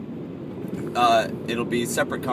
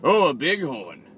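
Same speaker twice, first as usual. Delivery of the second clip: lightly imitated comic line, drawn out and higher; slow, raised mock exclamation; Will Ferrell; 4 semitones higher, 4 dB louder than usual. Quoting the show line someone shouted back, lightly imitating Will Ferrell. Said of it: "something that Will Ferrell would say".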